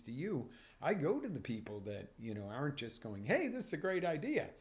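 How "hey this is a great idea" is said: bright, louder hype pitch; mock excited. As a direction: sell it like an eager pitchman.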